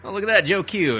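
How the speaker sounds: ordinary talk, nothing marked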